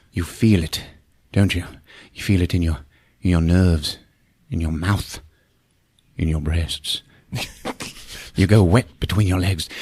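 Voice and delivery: low, soft, halting menace; creepy seducer. Retold or imitated. Paul taunting a frightened woman, creepy seducer. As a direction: drop low and linger between phrases.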